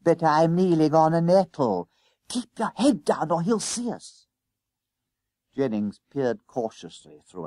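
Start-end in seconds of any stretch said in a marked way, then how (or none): 0.0-1.9 high, loud schoolboy whine; pained protest. A narrator's reading. Stung boy protests while hiding. 2.3-4.3 high, tense schoolboy order; urgent warning. A narrator's reading. Hiding boy orders friend down.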